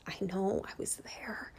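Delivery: barely voiced, breathy confession; whisper. Real, not acted. Confessing to struggling viewers she was there, in a whisper. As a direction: whisper it like a painful secret. Admitting past depression to viewers.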